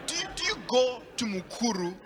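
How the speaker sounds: usual speaking voice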